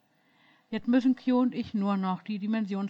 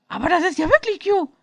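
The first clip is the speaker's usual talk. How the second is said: much higher and louder, stunned stammer; stammering in awe; 12 semitones higher, 11 dB louder than usual. A narrator's reading. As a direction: blurt it out in disbelief. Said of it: "stammelte er".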